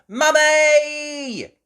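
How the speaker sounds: high, loud call; girl calling upstairs; shouting. Acted. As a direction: shout for Mummy. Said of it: "shouted Peppa".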